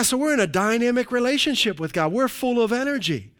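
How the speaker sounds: ordinary delivery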